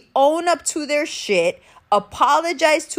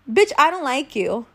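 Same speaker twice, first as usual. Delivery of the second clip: normal talking voice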